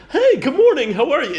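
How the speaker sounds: high, bubbly salesman greeting; cheery dealer. Pretend. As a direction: chirp it like an overly friendly car dealer. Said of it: "imagining the dealer with the mug".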